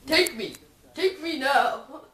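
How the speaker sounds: loud, begging train voice, slowing at the end; pleading train. Acted. A talking train begging the narrator to ride it, loud and pleading. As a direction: beg loudly like a lovesick train.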